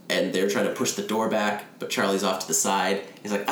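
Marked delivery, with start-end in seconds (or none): none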